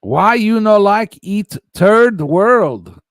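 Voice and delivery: loud, slow, high broken-English taunt; mock broken English. Streamer mocking the chat's taunt in broken English, loud and slow. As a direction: ham up a pushy foreigner's question.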